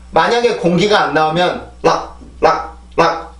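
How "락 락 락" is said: 락 cut short with no air; without airflow. Shown as a demonstration. Teacher shows L with no airflow, cut off. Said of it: "이만큼밖에 안 돼요".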